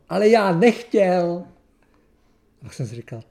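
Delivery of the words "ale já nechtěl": loud, drawn-out cry, pitched up; retold shouted protest. Preacher reliving his excuse to God, loud drawn-out protest. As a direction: shout it slowly in self-defence. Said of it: "znova začal jako vykřikovat".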